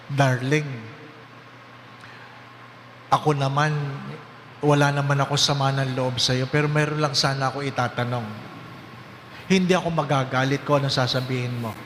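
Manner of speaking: a hint of a low, gentle dying husband; husband's voice. Priest voicing husband asking his dying wife. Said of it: "Sabi ni mister sa kanyang misis".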